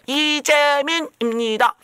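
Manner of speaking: high, loud, slow schoolkid introduction; hammed-up goody-goody kid; kid's recital. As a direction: recite it like a proud kindergartener. Child reciting name.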